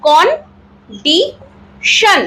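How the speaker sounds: Condition very slowly as kon, di, shan; three separate syllables in condition, pausing between; ভেঙে ভেঙে তিন ভাগে. Shown as a demonstration. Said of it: "তিন ভাগে ভেঙে ভেঙে উচ্চারণ". (কন্ডিশন শব্দটা তিন ভাগে থেমে থেমে)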